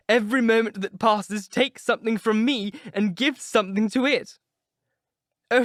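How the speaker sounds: high, loud, near-tearful lament; anguished outburst. Acted. Dorian crying out against the painting, anguished. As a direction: wail at the portrait that will outlive him.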